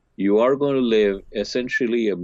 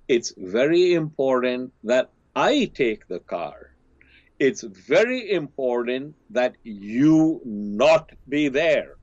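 put-on slow, drawn-out teen pleading every word; lightly voiced son, slow and deliberate; slow insistent son; 4 semitones higher, slower than usual. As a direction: spell it out slowly to mom. Son pressing mom for car.

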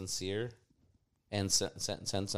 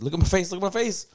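much higher, louder eager plea; excited quote; 10 semitones higher, 8 dB louder than usual. Retold or imitated. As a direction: beg them to look, worked up. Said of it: "He's like".

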